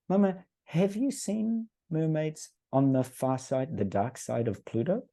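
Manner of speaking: put-on high, slow, earnest little-girl question; imitating his young daughter. Daughter cross-examining her mother, high slow childlike voice. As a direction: lay out the trap like a clever eight-year-old.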